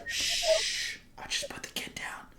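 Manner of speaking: shh, then a voiceless whisper; shushing whisper. Pretend. Parent shushing guests after putting kid down, whisper. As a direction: hush the guests urgently. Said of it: "It's like".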